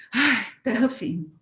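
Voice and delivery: slow, relieved sigh of arrival. Retold or imitated. Arriving safely, relieved and slow. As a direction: breathe it out like kissing the ground. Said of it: "queria fazer como o Papa".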